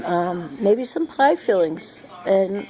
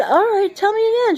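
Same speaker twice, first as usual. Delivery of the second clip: put-on high, loud playful reply to the child; mock high voice; 12 semitones higher, 3 dB louder than usual. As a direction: humor the little one in her pitch. Grandma humoring the child.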